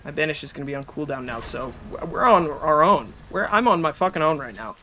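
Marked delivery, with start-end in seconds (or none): none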